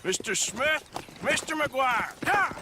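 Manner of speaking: calling out. Acted. Leader summoning gang to ambush.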